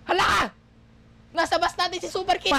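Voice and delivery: high-pitched, urgent warning; alarmed discovery. Pretend. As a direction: raise the alarm, playfully panicked. Spots enemy Super Kid on bus. (gulat at natatarantang sigaw)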